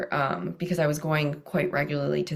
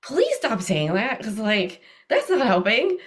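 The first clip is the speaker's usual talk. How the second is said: pleading; 6 semitones higher, 4 dB louder, slower than usual. Retold or imitated. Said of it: "I'm just sitting there going".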